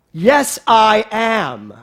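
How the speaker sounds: very slow, loud Yes I am; preacher urging the congregation to affirm; bold declaration. Real, not acted. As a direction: claim it boldly.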